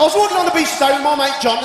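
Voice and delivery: slow, high, laughing retelling; slow laughing restart of the line; drawn-out restart. Shown as a demonstration. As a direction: stretch it out with a laugh. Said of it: "okay, you're it".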